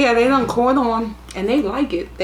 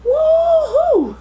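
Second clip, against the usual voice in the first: very high, long held "woohoo"; excited whoop; 4 dB louder than usual. Real, not acted. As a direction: let out a high cheer. Cheering as she gets excited.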